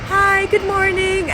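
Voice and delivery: put-on high, bright-eyed teenage boy saying hi; cheery teen greeting. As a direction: mimic a friendly kid waving hello. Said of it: "It was like".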